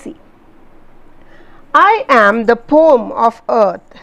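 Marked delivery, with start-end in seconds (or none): 1.7-4.0 slow, weighty recital of the line; poem recital. Shown as a demonstration. Teacher reciting the rain's words to students. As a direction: let the rain's line ring out.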